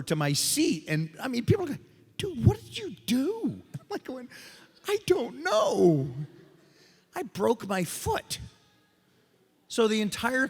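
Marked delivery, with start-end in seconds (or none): 2.2-3.6 high, slow incredulous question; exaggerated disbelief; mock alarm. Acted reaction to his fall. 4.9-8.4 high, slow, drawn-out wail; hammed-up agony, dragging the words out; mock pained whine. Acting himself hurt after jump.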